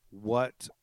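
normal talking voice

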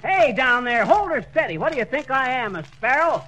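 shouting down. Acted. McGee yelling down from the ladder at the helper. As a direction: yell down to the helper below.

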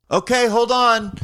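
high, loud and slow, thrown off; a panicked caller stalling; mock-panicked phone voice; flustered. Panicked retold phone call.

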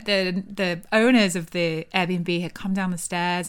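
ordinary talk

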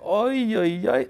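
high ay ay ay; mock alarm. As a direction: yelp in comic panic. Brushing teeth while driving. (quejido agudo de alarma)

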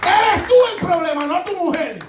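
high, loud preacher's declaration; preaching at full force. Retold or imitated. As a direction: nail the blame on him. Preacher confronts the man. (predicador acusando en voz alta y aguda)

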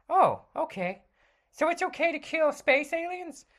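high, bright sidekick voice; Robin voice. Acted. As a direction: play the eager young sidekick.

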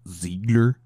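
German pronunciation of a name; switch from American to German. Shown as a demonstration. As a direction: slip out of American into a German accent. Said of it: "speaking with my American accent and all".

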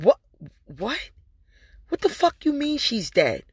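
high, stunned retelling of her own shock; shocked disbelief. Retold or imitated. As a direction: react as if hearing terrible news. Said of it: "I'm like".

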